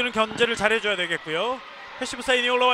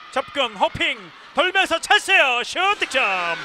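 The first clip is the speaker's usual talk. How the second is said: slow, loud, high build-up call; excited goal call; 3 semitones higher, 5 dB louder, slower than usual. Real, not acted.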